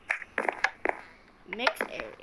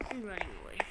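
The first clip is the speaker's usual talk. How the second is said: ordinary talk, nothing marked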